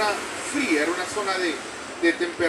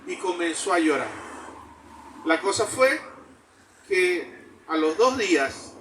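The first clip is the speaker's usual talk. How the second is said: normal talking voice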